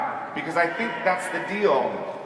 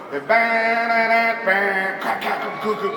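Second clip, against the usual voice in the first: vocal drum imitation; 4 semitones higher than usual. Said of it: "If you listen to a song like [name]".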